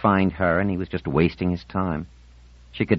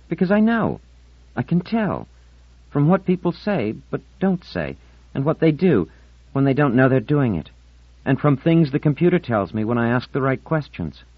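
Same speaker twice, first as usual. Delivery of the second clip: put-on much higher girlish voice, defensive; insistent Marlena; 9 semitones higher, 4 dB louder than usual. Marlena tells Ornell how she knows, high and insistent. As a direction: insist on it in a high, urgent girl's voice.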